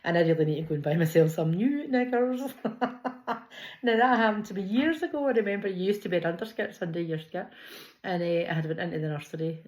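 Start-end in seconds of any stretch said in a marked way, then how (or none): 2.5-3.8 breathy, high giggling fit; helpless laugh. Real, not acted. Joking with viewers about buying new knickers, giggling. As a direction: laugh at yourself, unable to stop.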